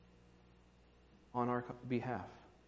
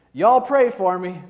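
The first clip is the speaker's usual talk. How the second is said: put-on southern child crying out; 3 semitones higher, 10 dB louder than usual. Child hauled out of church, loud Southern accent plea. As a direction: call out in a Southern accent like a scared kid. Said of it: "crying out in a charming southern accent".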